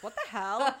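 loud, exasperated what the hell; incredulous outburst. Retold or imitated. Recalling her reaction to the film, a loud exasperated outburst. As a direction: blurt it out in disbelief. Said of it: "I was like".

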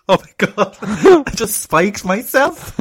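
high, loud, through laughter; real laughing disbelief. Real, not acted. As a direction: crack up while confessing it. Laughs telling own accidental spiking.

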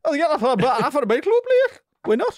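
high-pitched, loud Geordie impression; mock Geordie accent. Imitating a friend's joke Qatari owner on his stream, in a mock Geordie accent. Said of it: "He did a Geordie accent".

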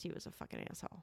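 low, voiceless mutter. Real, not acted. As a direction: mutter it under your breath. Muttered insult.

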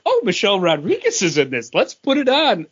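light high-pitched imitation of the wife; a hint of an excited wife; mock Kate voice. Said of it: "would be a sentence she would say".

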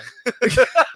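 short real burst of laughter; laughing. Real, not acted. Co-host joking about the fatal botch, laughing. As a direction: crack up briefly at a dark joke. Reacting to own dark wrestling joke.

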